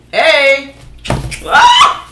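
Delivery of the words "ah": sudden high yelp as something spills; startled yelp. Real, not acted. Vlogger yelps as her ingredients spill, startled high yelp. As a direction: cry out at the spill. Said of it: "I'm over here having too much fun".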